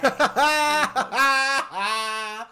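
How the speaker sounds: big burst of laughter; loud laugh. Real, not acted. Laughing at Scotty's quote.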